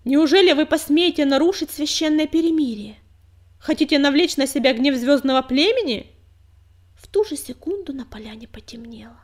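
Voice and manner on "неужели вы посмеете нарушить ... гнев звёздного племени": loud commanding. A narrator's reading. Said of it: "громко крикнула".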